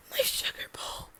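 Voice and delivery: whispered lament, on the verge of bawling; teeny voice. Acted. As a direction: whisper it, tiny and stricken. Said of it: "in this teeny voice".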